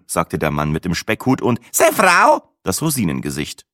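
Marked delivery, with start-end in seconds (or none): 1.7-2.6 put-on high, loud, clipped old man; old man grunting. Old Franconian man introduces his wife, loud and high. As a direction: bark two words as an old man.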